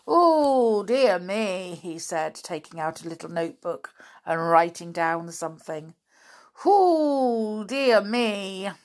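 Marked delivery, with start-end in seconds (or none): 0.1-1.8 loud, high, drawn-out "oh"; mock-solemn, falling from a high start; grave dismay. A narrator's reading. Doctor shakes his head over Binkle, gravely dismayed. 6.6-8.7 loud, high, drawn-out "oh"; mock-solemn, falling from a high start; grave dismay. A narrator's reading. Doctor shakes his head over Binkle, gravely dismayed.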